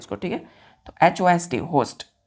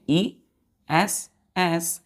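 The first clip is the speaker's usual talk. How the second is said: एस एस stretched out very slowly; teacher spelling एस एस slowly to class; much slower than usual. Shown as a demonstration. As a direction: drag out each एस. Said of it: "spelling the ending".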